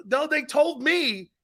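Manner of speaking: slow, high-pitched protest. Retold or imitated. Grandson defending what the coach told him, protesting. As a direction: stretch it out as a mock-defensive plea. Defending himself in retold argument.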